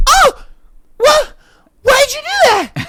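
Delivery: high, squeaky mock-offended lady voice; imitating the lady. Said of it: "and she's like".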